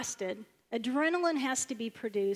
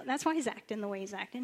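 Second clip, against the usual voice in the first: usual speaking voice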